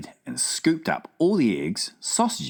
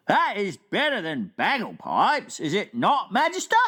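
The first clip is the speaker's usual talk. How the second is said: BFG voice; 12 semitones higher than usual. Acted. Giant asking the Queen at breakfast, wondering. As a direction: puzzle it out like a big gentle giant.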